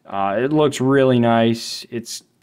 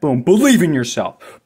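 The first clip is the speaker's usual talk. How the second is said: ordinary delivery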